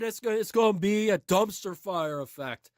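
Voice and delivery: ordinary talk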